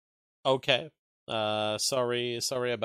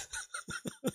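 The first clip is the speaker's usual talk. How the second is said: breathy unvoiced laugh; 11 dB quieter than usual. Real, not acted. Amused at own mockery.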